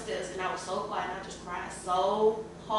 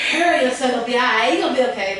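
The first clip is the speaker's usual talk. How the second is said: louder, higher, heartfelt reassurance; emphatic reassurance; 4 semitones higher, 10 dB louder, faster than usual. Retold or imitated. What she heard in the text, said loud and heartfelt. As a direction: say it like you finally believe it. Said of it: "It was like, I felt like".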